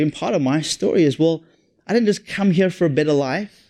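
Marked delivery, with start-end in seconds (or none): none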